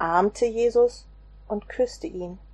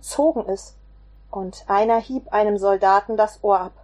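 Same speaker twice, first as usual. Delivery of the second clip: ordinary delivery, nothing marked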